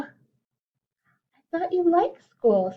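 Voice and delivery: slow, gently puzzled mother voice, pitched high; a light motherly voice, drawn out; mother raccoon. Acted. Mother asking her cub about school, slow and puzzled. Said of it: "said Missus Raccoon".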